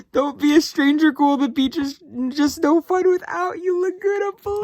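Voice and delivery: very high, squeaky fangirl voice; high emotional falsetto re-reading; emotional squeal. Real, not acted. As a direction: squeal the line in overwhelmed delight. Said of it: "I'm literally gonna start crying".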